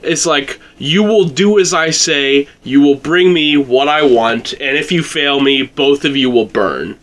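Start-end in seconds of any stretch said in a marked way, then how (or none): none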